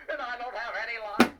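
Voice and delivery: ordinary delivery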